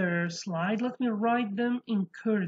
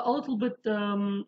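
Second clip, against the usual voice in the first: ordinary talk, nothing marked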